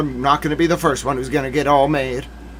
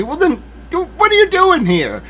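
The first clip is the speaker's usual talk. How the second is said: incredulous; 11 semitones higher than usual. Real, not acted. Exasperated retort.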